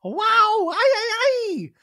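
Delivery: very high, loud startled squeal; hammed-up imitation of a scream; mock shriek. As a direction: shriek as if suddenly spooked. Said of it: "suddenly jumps and shrieks out loud".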